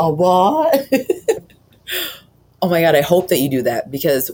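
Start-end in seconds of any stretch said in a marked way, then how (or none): 0.0-0.9 loud, higher laughing echo; sassy movie quote. Retold or imitated. Co-host echoing the favourite line, laughing sassy imitation. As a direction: toss back mock disbelief through a laugh.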